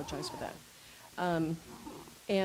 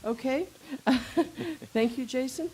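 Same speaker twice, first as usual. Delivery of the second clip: laughing; 6 semitones higher, 6 dB louder than usual. Real, not acted. Chair laughs at hat.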